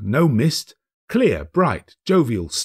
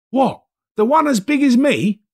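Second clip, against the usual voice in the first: put-on high, loud boy's reply; boy calling back up; cheeky lad; street boy; 6 dB louder than usual. Boy voiced.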